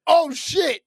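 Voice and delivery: high, startled "oh, shit"; shocked gasp. Retold or imitated. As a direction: react like you just saw a punch land. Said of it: "And I was like".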